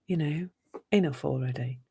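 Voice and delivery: quiet, low mock exasperation; fed-up dismissal. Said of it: "push the Joker out and go".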